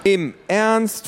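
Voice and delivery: high, disbelieving outburst; incredulous. A narrator's reading. Luisa reacts to Papa keeping the neighbour, in disbelief.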